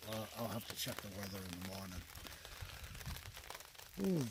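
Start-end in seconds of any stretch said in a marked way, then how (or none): 4.1-4.3 talking through a yawn; words stretched by a yawn. Real, not acted. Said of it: "I was yawning".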